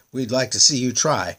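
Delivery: taunting. A narrator's reading. Eagles taunt the prince.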